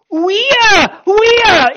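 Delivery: "Ouia" cried very high and loud, falling; two loud high WEE-ah cries; loud Greek ouia. Shown as a demonstration. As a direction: cry "ouia" as an urgent alarm. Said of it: "Whatever it is, this".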